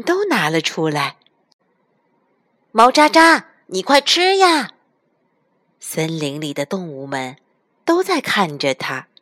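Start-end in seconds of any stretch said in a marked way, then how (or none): 2.7-4.7 urging excitedly. Acted. Animals urge him.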